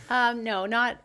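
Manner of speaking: laughing through the words, loud and high. Real, not acted. Laughing while answering the host's question about programs. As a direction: giggle out a flustered no.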